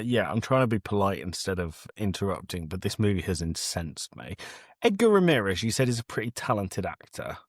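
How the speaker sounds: ordinary talk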